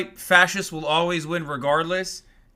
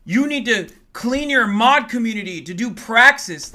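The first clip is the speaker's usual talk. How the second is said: high, loud mock gush; sneering impression of an awestruck follower, higher and louder; mocking gushing fan; 5 semitones higher, 7 dB louder than usual. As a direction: ham up a starry-eyed fan, slowing on oh my god. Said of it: "their dumb fucking perverted fans watch it and go".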